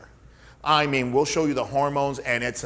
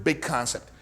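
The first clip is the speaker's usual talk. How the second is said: usual speaking voice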